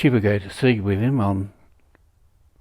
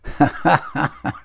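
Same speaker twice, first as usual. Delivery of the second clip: short chuckle at his own remark; laugh; 5 semitones higher than usual. Real, not acted. Speaker amused by his own question about women, chuckling. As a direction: chuckle to yourself, a little higher.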